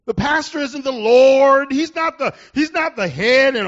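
loud, slow declaration; preaching at full force. Real, not acted. Pastor thundering at the congregation, preaching at full force. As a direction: proclaim it to the whole room.

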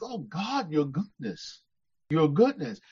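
a whispered prayer of wonder; hushed adoration. Retold or imitated. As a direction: breathe it out in quiet awe. Revelling in God's goodness aloud.

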